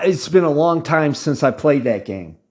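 normal talking voice

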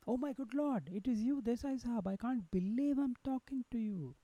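mild, awed tearfulness at meeting a hero; tearful. A narrator's reading. Prisoner overwhelmed at meeting Desai, mildly tearful. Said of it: "said the man with tears in his eyes".

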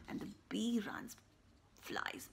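ordinary delivery

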